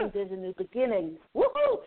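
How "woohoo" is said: high, loud joyful whoop; excited cheer. Real, not acted. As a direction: echo the cheer gleefully. Reader echoes the cheer.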